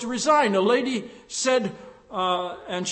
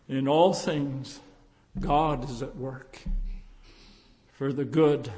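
reverent scripture; 8 semitones lower than usual. Real, not acted. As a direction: read the verse gently and low.

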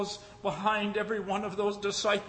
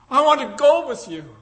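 high, louder joyful repeat; joyful declaration; 7 semitones higher, 7 dB louder than usual. Acted. Freed woman choosing to follow Lincoln, high and joyful. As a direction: say it again with full feeling. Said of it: "she smiled and said".

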